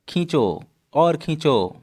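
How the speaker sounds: high, drawn-out calls; urging a pull. Acted. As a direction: stretch each command out as if straining to pull. Urging others to pull the sweater.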